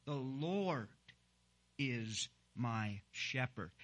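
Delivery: "Lord" held long, then a pause, slow finish; stress on Lord. Shown as a demonstration. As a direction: stretch "Lord", pause, then go slowly through the rest. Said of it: "is the word Lord".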